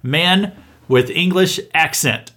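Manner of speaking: slow, every word leaned on, mocking the headline; mocking emphasis. Commentator mocking a news headline to his audience, slow and pointed. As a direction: spell out "Man with English accent" slowly, in disbelief. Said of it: "that's how they reported it".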